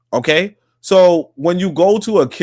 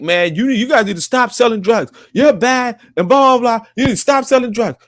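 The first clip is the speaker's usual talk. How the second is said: mock preachy lecture; 6 semitones higher than usual. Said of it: "I'm just like".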